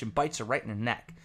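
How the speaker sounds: normal talking voice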